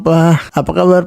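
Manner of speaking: ordinary talk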